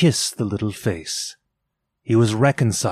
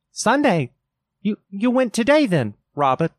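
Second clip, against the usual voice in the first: much higher, quicker anxious question; wife's question; 10 semitones higher, 3 dB louder than usual. A narrator's reading. Mrs Cratchit to Bob, high anxious question. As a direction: ask it quickly, catching.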